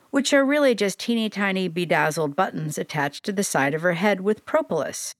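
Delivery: ordinary delivery, nothing marked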